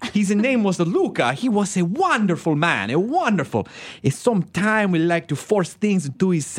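high, slow hammed-up Italian accent; mock Italian accent. Mocking a sweet Italian director, hammed-up Italian accent. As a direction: play the sweet Italian director saying something crude, Italian accent. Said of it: "take his adorable accent".

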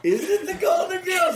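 Golden shouted high through laughter; laughing mock repeat. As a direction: parrot his guess while cracking up. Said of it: "laughing at it".

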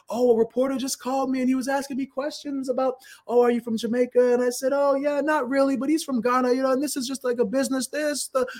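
high, lightly voiced mother recounting the call; imitating mom. Mom retells the reporter's call.

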